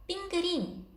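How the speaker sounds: high-pitched "핑그린" with ㄹ in the last syllable; audible ㄹ sound. Shown as a demonstration. Teacher checking the learner's question, high "핑그린" with audible ㄹ. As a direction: say "핑그린" high, ending in "rin". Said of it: "리을 발음이 들리는 거죠?".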